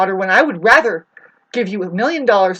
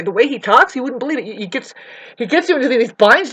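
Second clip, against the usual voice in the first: ordinary talk, nothing marked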